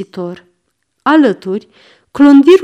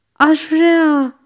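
a touch of sad longing, higher; 5 semitones higher than usual. Acted. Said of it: "răspunse trist hagiul". (trist, cu dor)

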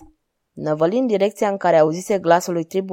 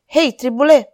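raised, higher-pitched call; louder searching shout; 5 semitones higher, 5 dB louder than usual. A narrator's reading. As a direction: hail someone who has gone ahead. Calling after vanished companion.

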